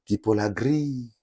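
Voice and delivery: normal talking voice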